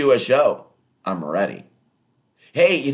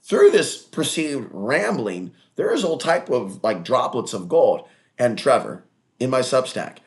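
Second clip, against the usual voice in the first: usual speaking voice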